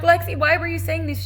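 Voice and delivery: high, loud, urgent question; re-enacted alarm; panicked. Upset mother questioning her daughter on the phone, retold panic.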